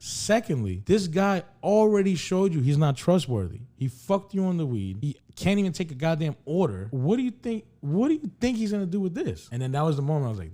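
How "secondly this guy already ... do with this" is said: high-pitched, exasperated mock rant; retold angry scolding. Retelling her warning about the untrustworthy dealer, exasperated scolding. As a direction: imitate her fed-up lecture, pitch climbing toward the questions. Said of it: "She's pissed off at me".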